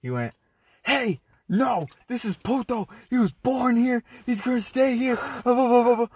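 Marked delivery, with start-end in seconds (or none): 0.8-5.2 very high-pitched mock protest; imitated yelling back. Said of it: "second shifters were yelling back". 5.2-6.1 high-pitched blah-blah rant; mock yelling gibberish. Retold yelling trails into blah.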